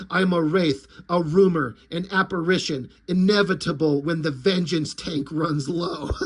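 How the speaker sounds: deadpan action-hero growl; grim vigilante. Retold or imitated. Popo boasting to the lizards, low brooding. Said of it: "So then Popo continues".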